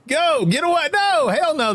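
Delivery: joyful refusal to believe; excited disbelief. Real, not acted. Disbelief at raid.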